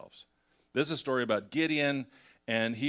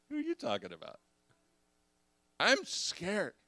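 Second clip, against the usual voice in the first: scared Gideon; 7 semitones higher than usual. Retold or imitated.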